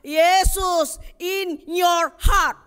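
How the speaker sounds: slow, high and loud proclamation; ringing shout. Acted. Preacher answers the child, slow ringing shout. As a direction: stretch it out and shout it to the hall.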